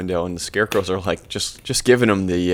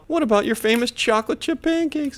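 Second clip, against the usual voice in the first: put-on much higher kid voice; mock kids. Kids asking Grandma for her famous pancakes, mock high voice. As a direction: plead as kids in mock falsetto. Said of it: "they're like".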